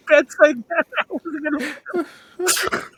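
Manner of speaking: high, helpless laughter through the words; cracking up. Real, not acted. Laughing at a player's blunt news to orphans, cracking up. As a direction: talk through a fit of laughter.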